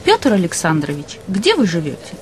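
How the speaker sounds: ordinary talk, nothing marked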